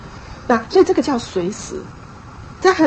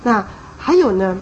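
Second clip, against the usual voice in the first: usual speaking voice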